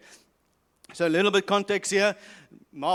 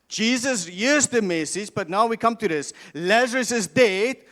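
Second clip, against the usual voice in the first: normal talking voice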